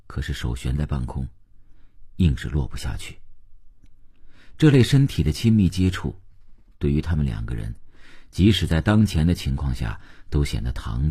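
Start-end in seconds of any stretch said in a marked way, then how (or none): none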